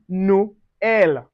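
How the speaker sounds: "Noël" slowly as no, el; separated vowels. Shown as a demonstration. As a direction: break "Noël" into two distinct vowels. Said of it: "separates a vowel from distinct pronunciations".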